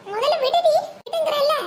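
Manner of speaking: a relative pulling the attacker off, shouting; urgent yell. Acted. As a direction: yell at her to let go now.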